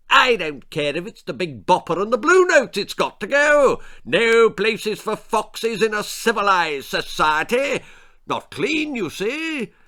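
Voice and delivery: a haughty lord laying down the law; pompous lord. Acted. Lord Percy insists.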